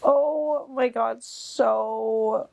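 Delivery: slow, high, on the verge of crying; near tears. Real, not acted. To viewers, slow high near-tearful plea. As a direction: hold back happy tears. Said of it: "don't cry".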